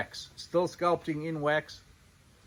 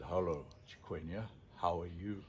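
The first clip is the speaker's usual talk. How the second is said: softer, lower, unruffled hello to the elephant; calm greeting; 8 semitones lower, 12 dB quieter than usual. Retold or imitated. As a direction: greet her softly as if nothing happened. Calm man greets charging elephant.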